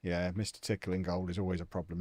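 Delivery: normal talking voice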